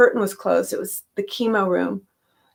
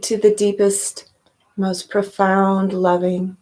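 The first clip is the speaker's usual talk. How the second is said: guided meditation. Real, not acted. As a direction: gently lead listeners inward, leaving space between phrases.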